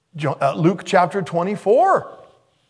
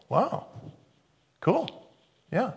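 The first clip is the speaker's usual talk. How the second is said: feigned interest; 6 semitones higher, 7 dB quieter, slower than usual. Acted. Jesus feigning interest in their account.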